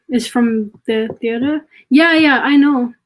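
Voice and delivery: ordinary delivery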